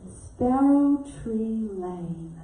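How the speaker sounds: very slow, lingering over each word; admiring the lovely address; savoured slowly. Retold or imitated. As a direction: relish the address like a treat.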